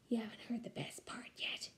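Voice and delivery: a secret, breathy whisper. Acted. Grandpa whispering a secret plan to his granddaughter. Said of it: "he whispered".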